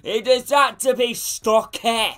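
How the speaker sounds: high, loud sing-song gloat; excited gloating. Real, not acted. Streamer to his chat after the goal, gloating sing-song. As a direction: crow over the goal in a stretched sing-song.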